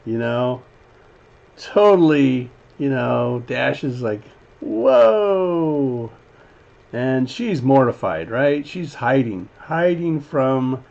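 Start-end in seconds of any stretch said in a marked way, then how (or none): 4.6-6.1 long drawn-out whoa, falling from high; amazed reaction. Acted. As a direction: play a wowed onlooker, raised pitch. Acting out Dash's stunned reaction.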